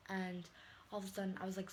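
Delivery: ordinary talk, nothing marked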